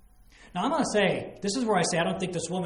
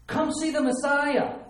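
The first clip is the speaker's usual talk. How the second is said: "Come see the Messiah" higher, louder and slower; eager call; 9 semitones higher, 7 dB louder than usual. Shown as a demonstration. Woman urging the city men to come, excited call. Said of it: "No, that's not what happened".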